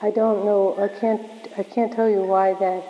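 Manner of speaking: normal talking voice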